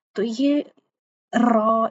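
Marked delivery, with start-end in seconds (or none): 1.3-1.9 را said loud with an over-rolled r; wrong raa. Shown as a demonstration. Teacher shows students the wrong way to say را. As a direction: roll the r of را too hard, the wrong way. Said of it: "اس طرح سے آپ نے را نہیں پڑھنا".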